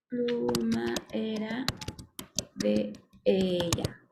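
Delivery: drawn-out words with gaps; stretched, word-by-word dictation; slow dictation. Shown as a demonstration. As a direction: say each word slowly as you type it. Typing the rest of the example.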